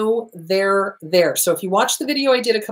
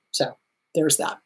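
soft whispered aside; muttered off-mic whisper; 4 semitones lower, 9 dB quieter than usual. Real, not acted. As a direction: whisper it to yourself, slower. Aside to herself, dropping voice.

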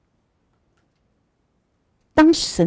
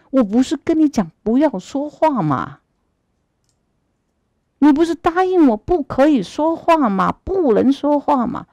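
much higher, rebuking questions; scolding; 7 semitones higher than usual. Acted. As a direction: scold him for breaking his promise. True god scolds fake god.

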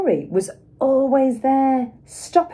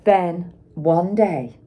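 usual speaking voice

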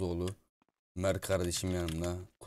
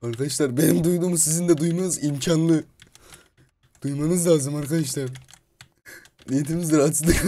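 high, loud panicked talk; hammed-up fright, louder and higher throughout; scared shouting; 9 semitones higher, 12 dB louder than usual. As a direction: play the spooked ghost hunter. Scared in the haunted house.

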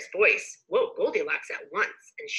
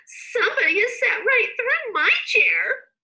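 very high, louder wailing little bear; crying Baby Bear; 6 dB louder than usual. A narrator's reading. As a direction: squeal it out like an upset baby bear. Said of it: "cried Baby Bear".

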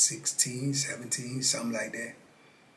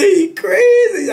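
much higher and louder, slow, cracking with laughter; squealing laugh-cry; 13 dB louder, much slower than usual. Retold or imitated. Telling Pito the old man is crazy, squealing with laughter. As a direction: let the words break into a disbelieving squeal.

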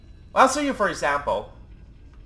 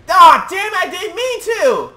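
sudden high yelp of dismay; startled shout; 10 semitones higher, 8 dB louder, slower than usual. Real, not acted. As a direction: shout it out, caught off guard. Accidental kill in game.

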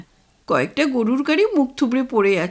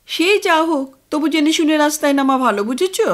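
insistent wife; 6 semitones higher, 5 dB louder than usual. Acted. Wife insists on asking directions.

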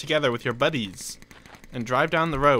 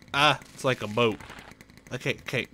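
ordinary talk

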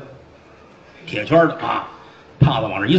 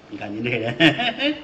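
plain reading voice

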